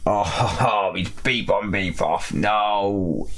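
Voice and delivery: ordinary delivery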